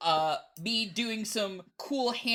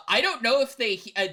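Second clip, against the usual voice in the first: ordinary talk, nothing marked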